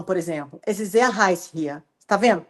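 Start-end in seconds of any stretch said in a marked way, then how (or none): 0.7-1.8 low, level tune on "Es ist sehr heiß hier"; flat German. Shown as a demonstration. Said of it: "é, é flat, ele não tem". (alemão sem emoção, "Es ist sehr heiß hier" sem entonação)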